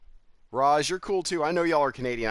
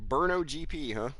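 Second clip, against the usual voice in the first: usual speaking voice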